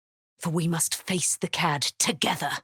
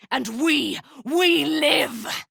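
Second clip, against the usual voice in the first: rising higher to a joyful shout; triumphant cry; 9 semitones higher, much slower than usual. Acted. Dame Aylin celebrating survival, triumphant shout. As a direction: throw it out in triumph.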